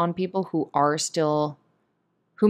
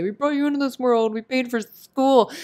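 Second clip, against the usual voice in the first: mock guilt-tripping parents; 6 semitones higher than usual. Imitating parents guilt-tripping their child. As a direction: sneer the parents' guilt-trip lines. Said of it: "your parents may try to guilt you and say".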